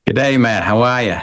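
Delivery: brief put-on Australian accent greeting. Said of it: "that's as much as you'll get from my Australian accent".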